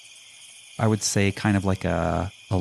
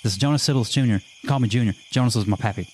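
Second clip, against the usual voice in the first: put-on higher, lively character voice with an accent; excitable, higher-pitched accented character; 6 semitones higher than usual. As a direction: introduce yourself as an eager newcomer, accent on. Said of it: "how to do my accent".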